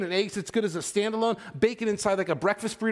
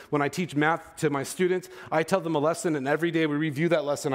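normal talking voice

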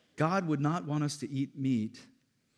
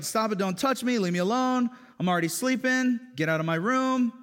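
mock-irritated wife, higher and louder; annoyed wife; 10 semitones higher, 8 dB louder than usual. Wife rejecting husband, mock irritated. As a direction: brush him off with hammed-up annoyance. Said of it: "And she's like".

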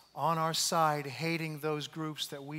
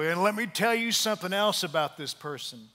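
louder, eager gossip voice; gossipy mock; 4 semitones higher, 9 dB louder than usual. Imitating a gossip jumping on the bandwagon, eager. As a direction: lean in to spill the dirt.

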